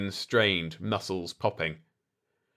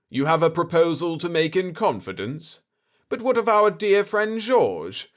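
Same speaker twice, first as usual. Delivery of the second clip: raised, sly questioning tone; sly potentate; 8 semitones higher, 5 dB louder than usual. Acted. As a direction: fish for the secret sweetly. Aga Khaga probes slyly.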